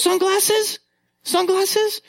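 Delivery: put-on very high questioning little girl; toddler voice. Dad imitating his daughter spotting his sunglasses, very high. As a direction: ask it like a curious two-year-old. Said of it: "She goes".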